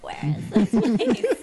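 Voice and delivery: loud, drawn-out mock call breaking into laughter; retold searching shout. Camper retelling a fruitless hunt for Zissou camp, laughing mock shout. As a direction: call out as if hopelessly lost, half laughing.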